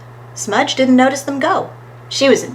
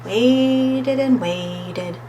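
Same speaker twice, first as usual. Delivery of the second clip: very slow, stretched-out words; long, drawn-out waiting; much slower than usual. A narrator's reading. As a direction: drag each word to make the wait endless. Story stresses long waiting.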